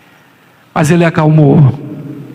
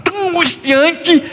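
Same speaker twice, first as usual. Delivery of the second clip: rising anguish; 9 semitones higher than usual. Acted. Preacher building intensity.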